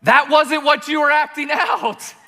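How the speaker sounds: high, loud indignant protest; outraged at the bad acting. Guessers protest to the actor, high and indignant. Charades crowd reacting to the answer.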